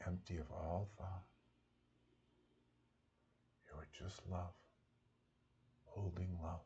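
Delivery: soft, fading meditation voice; guided meditation. Acted. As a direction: settle them into silence, softly.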